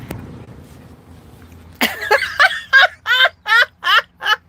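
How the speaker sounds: long, very high and loud cackle; high cackling laugh. Real, not acted. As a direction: burst into a shrieking cackle. Laughing at the situation.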